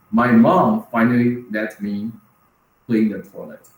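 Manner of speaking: ordinary talk, nothing marked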